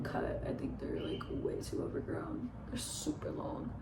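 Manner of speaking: soft breathy whisper to the camera. Real, not acted. Vlogger whispering to the camera about her bangs, whispered. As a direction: let it drop to a whisper.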